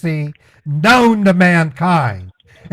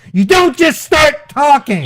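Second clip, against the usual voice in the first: shouting; 7 semitones higher than usual. Real, not acted. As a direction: yell it at the rep. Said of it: "I apologize for shouting".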